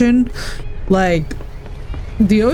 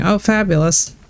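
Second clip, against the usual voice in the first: normal talking voice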